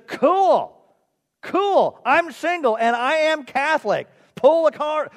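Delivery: high, loud, eager outburst; excited. Acted. Driver thrilled at the nun's offer of a kiss, excited. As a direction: blurt it out thrilled, pitch leaping up.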